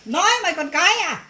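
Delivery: mother rebuking her daughter for talking back; angry retort. Acted. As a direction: cut her down for answering back.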